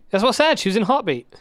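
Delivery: high, loud insistence; excited. Real, not acted.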